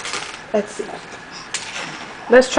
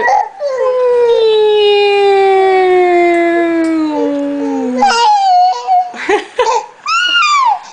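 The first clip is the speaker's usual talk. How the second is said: lip buzz airplane; 9 semitones higher than usual. Pretend. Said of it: "my airplane impression".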